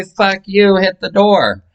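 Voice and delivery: high hammed-up telling-off; mock dismissal. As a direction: throw him out with flair. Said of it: "I say".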